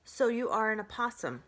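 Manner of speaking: ordinary delivery, nothing marked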